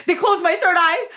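laugh-cry it in mock despair. Real, not acted.

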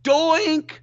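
high, loud sing-song "Doink!"; teasing yell. Retold or imitated. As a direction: yell it out to jinx the kicker. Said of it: "she yells out".

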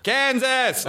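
high-pitched exclamation across empty plains; wide-open call. Shown as a demonstration. Interviewer showing the American landscape accent, calling out high. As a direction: call it out high, as over vast open land. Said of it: "you have to shout".

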